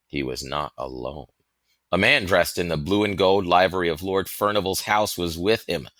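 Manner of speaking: plain reading voice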